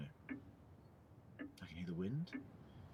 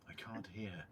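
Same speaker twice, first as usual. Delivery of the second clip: ordinary talk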